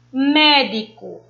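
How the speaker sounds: slow médico with a forceful, long MÉ; MÉÉ-di-co, first syllable emphasized; stress on the first syllable. Shown as a demonstration. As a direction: push the MÉ in médico, let the rest fall. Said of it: "ele tá com mais intensidade".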